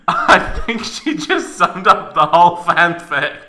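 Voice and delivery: breathless laugh into a loud remark; wheezing laugh. Real, not acted. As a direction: crack up at the absurdity. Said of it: "reader laughs at text".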